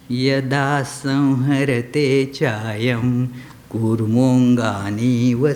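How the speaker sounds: slow, measured scripture chant; slow recited shloka; Sanskrit verse recitation. Real, not acted. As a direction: intone the sacred verse slowly, drawing out the syllables.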